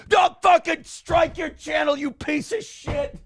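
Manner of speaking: high, slow mock rage; mock furious outburst. Imitating the writer raging about a channel strike, mock fury. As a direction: ham up the writer blowing his top. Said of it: "imitated angry writer outburst".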